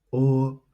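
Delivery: O held high and level; first tone. Shown as a demonstration. Teacher modelling tones for repetition, O high and flat. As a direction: keep O steady at a high pitch. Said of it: "which is high and flat".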